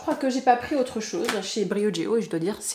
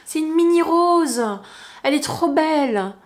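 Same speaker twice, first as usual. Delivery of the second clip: delighted; 7 semitones higher, 5 dB louder than usual. Real, not acted. Delighted by tiny rose petal.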